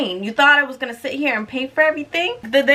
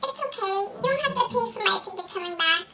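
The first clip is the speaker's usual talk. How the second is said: high, soft sugary mimic of the friend; high, soft mock of the friend's whisper in the ear; 10 semitones higher, 7 dB quieter than usual. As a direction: play her as naively sweet and hushed. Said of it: "thing she says in my ear".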